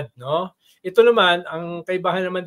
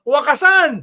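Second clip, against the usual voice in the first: higher, louder rally call "Wakasan!"; shouted slogan; 7 semitones higher, 7 dB louder, slower than usual. Retold or imitated. Salpanero calling the crowd at the rally, loud shout. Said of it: "Sisigaw siya".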